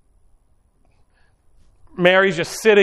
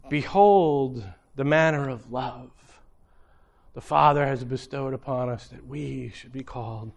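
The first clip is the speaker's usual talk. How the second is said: hushed scripture reading; 7 semitones lower, 8 dB quieter than usual. Retold or imitated.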